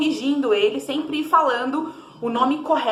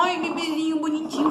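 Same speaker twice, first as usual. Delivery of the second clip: put-on slightly higher cooing baby voice; a hint of cooing at a baby; mild baby talk; 5 semitones higher than usual. Shown as a demonstration. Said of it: "nada de ficar". (leve voz de bebê)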